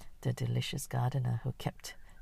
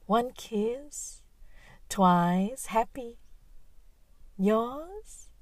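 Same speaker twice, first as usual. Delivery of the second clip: much higher, coy flirt; flirty high voice; 9 semitones higher than usual. Acted. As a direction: purr like a bar hostess flirting. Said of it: "asks the imperial question".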